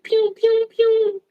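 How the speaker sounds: high mock gunfire "pew pew"; loud playful pew-pew blasts; gunshot noises. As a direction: spit out cartoon gun sounds. Imitating gunshots while ranting.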